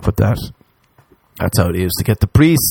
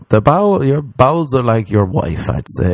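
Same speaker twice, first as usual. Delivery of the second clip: ordinary talk, nothing marked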